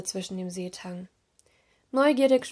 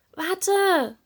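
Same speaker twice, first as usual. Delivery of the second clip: high, raised call to stop; 10 semitones higher, 5 dB louder than usual. A narrator's reading. Serena calling after her sister underwater, calling out. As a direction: call after someone swimming away. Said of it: "rief sie".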